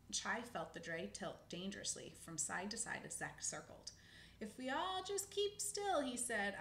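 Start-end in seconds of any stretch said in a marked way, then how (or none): 4.4-6.0 high, slow squirrel voice; high anxious squirrel; squirrel character voice. Acted. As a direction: voice a small worried squirrel, slowly. Squirrel voiced in read story.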